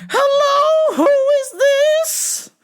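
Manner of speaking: put-on very high, loud and slow old lady; old lady caller. Old lady caller demanding on phone, very high and slow. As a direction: quaver it out like a frail old lady. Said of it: "So I was like / caller".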